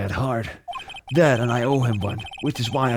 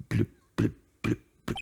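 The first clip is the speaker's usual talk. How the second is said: whispered text blips; 2 semitones lower, 8 dB quieter, faster than usual. Retold or imitated. Mimics text sound.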